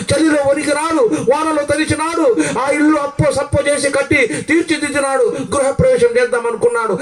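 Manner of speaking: ordinary delivery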